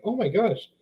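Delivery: normal talking voice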